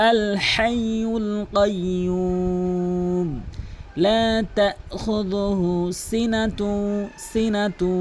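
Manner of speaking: slow, drawn-out melodic tilawat; Quran recitation. Acted. Reciting to viewers as a slow melodic model. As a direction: chant each word long and even.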